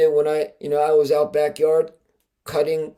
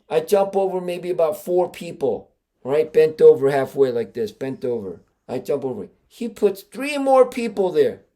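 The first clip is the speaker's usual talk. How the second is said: ordinary delivery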